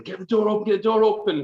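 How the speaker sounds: high, frantic mock shout; retold shouting. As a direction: shout to be let in, acting it out. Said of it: "I was shouting".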